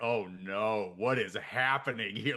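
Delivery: low-pitched inner groan of dismay; mock dismay. Host recalls being taken aback by the accent, dismayed. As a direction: voice a dismayed thought, dropped low. Retelling first reaction to accent.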